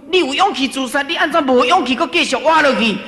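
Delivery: high, loud rebuking question; forceful quoted challenge. Retold or imitated. Quoting the master's challenge to despairing person.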